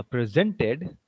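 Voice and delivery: slow "presented" said pri-ZEN-ted; stress on second syllable. Shown as a demonstration. Teacher showing the verb form, second-syllable stress on "presented". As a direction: stretch and hit ZEN in "presented". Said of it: "the stress will be on the second part".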